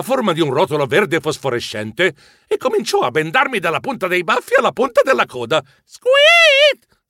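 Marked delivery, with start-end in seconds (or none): 5.8-7.0 very high, loud squeak; startled squeal. A narrator's reading. Narrator wrapped up by the ghost, startled squeal. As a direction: squeal like a squeezed mouse.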